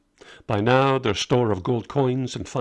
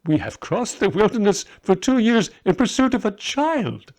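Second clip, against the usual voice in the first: amazed; 10 semitones higher, 4 dB louder than usual. Acted. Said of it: "not a laugh of derision".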